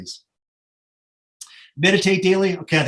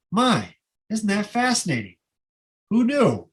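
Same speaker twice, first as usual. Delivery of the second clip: bright, curious, genuinely charmed; delighted wonder; 5 semitones higher, slower than usual. Shown as a demonstration. Tour leader showing travelers the right way, delighted wonder. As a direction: marvel at it like a happy discovery. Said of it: "But don't say it like this".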